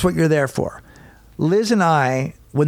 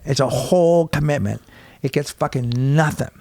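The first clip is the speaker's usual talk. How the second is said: ordinary talk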